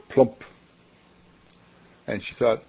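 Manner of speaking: ordinary talk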